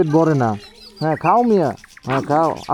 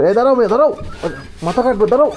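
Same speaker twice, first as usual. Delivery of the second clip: calling out; 8 semitones higher, 3 dB louder, faster than usual. Real, not acted. Stopping dogs at the door.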